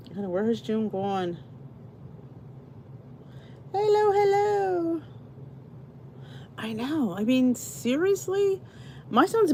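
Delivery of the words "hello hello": high, slow singsong hello; greeting a viewer who just joined, singsong and slow. Real, not acted. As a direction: sing out the welcome to a newcomer. Greets new viewers joining stream.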